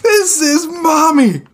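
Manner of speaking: put-on high, slow, tearful child's voice; crying child. Small child crying over mommy, high tearful voice. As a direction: whimper like a small kid.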